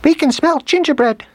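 very high, loud squeaky mouse voice; little mice chorus. A narrator's reading. Family of little mice smelling food, squeaky chorus. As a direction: pipe up like eager baby mice. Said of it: "chorused the whole family of little ones".

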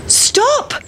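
high, shouted stop; mother shouting after the pancake. Acted. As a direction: call the pancake back at full pitch. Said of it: "shouted the mother".

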